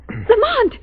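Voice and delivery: sharp frightened call of his name; alarmed call. Acted. Margot spotting the wound, calls out in fright. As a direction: cry out his name in sudden fear. Sees something on his throat.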